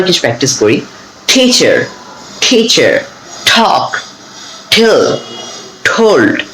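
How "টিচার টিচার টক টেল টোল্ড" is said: "টিচার" and "টক" with a strong aspirated t, slightly higher; crisp puff on each t; aspirated English t. Shown as a demonstration. Teacher drills words starting with an aspirated t. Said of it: "এভাবে আমরা উচ্চারণ করব".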